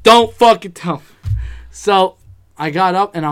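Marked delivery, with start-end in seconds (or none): 0.0-1.0 dreading loudly. Retold or imitated. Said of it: "the anticipation".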